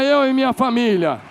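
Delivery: high, loud proclamation; loud declaration. Shown as a demonstration. Pastor leading the congregation's vow, loud and high. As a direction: proclaim it, lift the voice.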